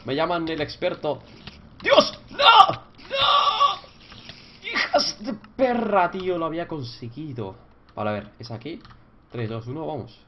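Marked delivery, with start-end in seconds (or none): 1.8-3.8 very high, loud wails of despair; anguished screams. Real, not acted. Gamer wailing as his progress is lost. 4.6-6.4 high, drawn-out angry yell; slow furious curse. Real, not acted. Gamer cursing the monsters. Raging after failing.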